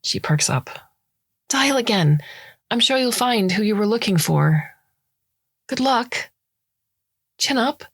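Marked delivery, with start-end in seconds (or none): 1.5-7.8 high, cheery brush-off; bright and dismissive, wrapping up the call; breezy politeness. Acted. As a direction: Sucharita chirpily dismissing a stranger. Sucharita politely ending call.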